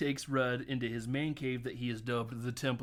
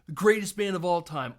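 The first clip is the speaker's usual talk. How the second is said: loud, raised fan declaration; gushing fan; 4 semitones higher, 7 dB louder than usual. Retold or imitated. New friend raving about the band Rush, loud and fervent. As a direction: proclaim it with mock fervour.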